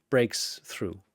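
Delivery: usual speaking voice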